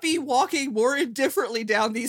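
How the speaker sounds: high, loud laughing talk. Real, not acted. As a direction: crack up while describing.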